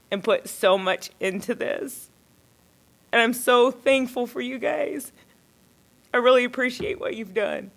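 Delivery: choked up and emphatic; tearful. Real, not acted. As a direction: push heartfelt gratitude out through rising tears. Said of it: "I'm gonna cry".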